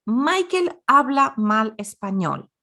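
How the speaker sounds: slow, deliberate model sentence; slow, careful example. Shown as a demonstration. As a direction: say it slowly, word by word. Teacher voices overheard example sentence.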